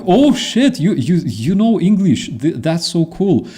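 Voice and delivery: high, excited mock astonishment; imitating a delighted, impressed colleague; impressed amazement. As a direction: act amazed, starting high and easing down. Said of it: "and they go".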